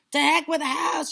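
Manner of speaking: put-on high, loud old woman's holler; defiant shout. A narrator's reading. Grandma dismissing boy's worry, defiant shout. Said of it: "she shouted".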